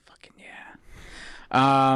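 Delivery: slow, whispered relish; slow breathy whisper. Real, not acted. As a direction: savor it in a hushed voice. Excited about blooming onion.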